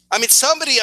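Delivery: normal talking voice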